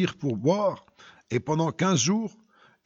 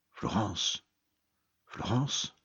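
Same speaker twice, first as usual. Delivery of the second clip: low, soft, disbelieving repeat of a name; stunned murmur; 6 semitones lower, 6 dB quieter, much slower than usual. Acted. Father finds his daughter's inscription, hushed disbelief.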